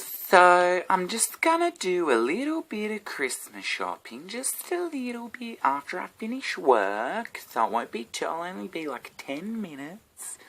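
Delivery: high, slow mocking girlfriend voice. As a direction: mimic her sweet excuse. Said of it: "A J comes out of nowhere and goes".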